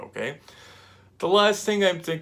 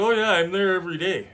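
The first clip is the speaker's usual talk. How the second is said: eager boast; 7 semitones higher, 5 dB louder, faster than usual. Retold or imitated. Eager self-quote to stranger.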